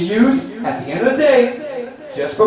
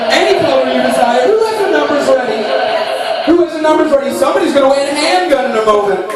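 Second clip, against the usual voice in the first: loud game show host; booming prize announcer; 3 dB louder, faster than usual. Acted.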